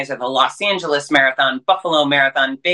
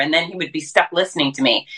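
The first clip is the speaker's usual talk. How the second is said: ordinary talk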